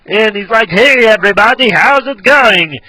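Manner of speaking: high, loud, stretched cartoon doctor voice; Nick Riviera. Shown as a demonstration. Showing viewers the doctor impression, high and loud. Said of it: "he sounds like this".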